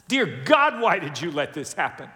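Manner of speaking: acted outburst of questioning; anguished plea to God. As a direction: cry out to God in protest.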